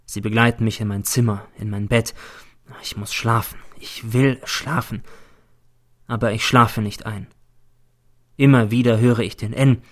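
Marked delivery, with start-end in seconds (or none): none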